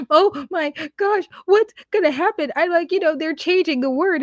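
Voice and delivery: mock whiny panic. Mocking crying TikTokers.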